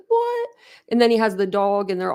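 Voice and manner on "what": squeaky, very high disbelieving what; stunned high-pitched what; shocked what. Retold or imitated. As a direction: shoot way up in disbelief. Reacting to the lost dog returning.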